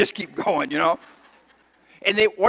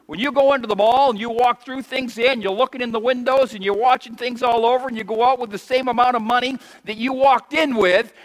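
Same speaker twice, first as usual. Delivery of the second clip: ordinary delivery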